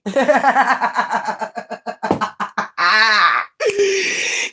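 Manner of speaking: real long, stuttering laugh; drawn-out mocking laughing fit; long cackle. Real, not acted. Laughs after mocking her haters.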